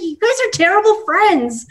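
high-pitched mock reproach; mock indignation. Acts out reproaching her friends.

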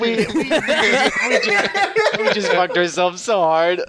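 talking through helpless laughter; laughing. Real, not acted. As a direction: stammer it out while laughing. Players laugh at overspending.

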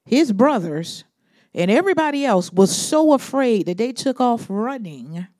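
preaching at full force. Acted. Preacher building up to the congregation, full force. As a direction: drive the story home to the congregation.

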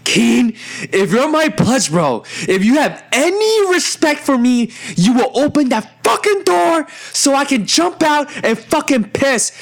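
high, desperate demand to a friend; desperate pleading. Retold or imitated. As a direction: act out a frantic, high-pitched plea. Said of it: "I've never felt so desperate in my life".

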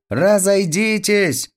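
put-on high, drawn-out boy's cry; acted high-pitched holler; shouted warning. A narrator's reading. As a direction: call out high to clear the road, stretching it. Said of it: "крикнул Петька".

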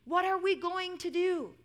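high, loud alarm; storybook shout. A narrator's reading. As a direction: call out in dramatised panic. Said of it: "the disciples shouted".

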